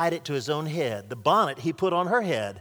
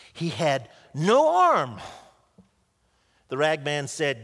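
usual speaking voice

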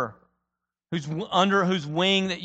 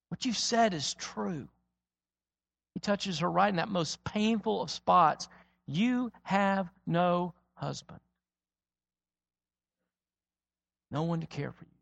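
tender, quiet; 6 dB quieter than usual. Acted. Jesus speaking softly to her.

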